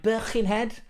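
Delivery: mock Liverpool accent, drawn out; terrible Scouse accent. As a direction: put on a bad Scouse accent. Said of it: "That's a terrible Scouse accent".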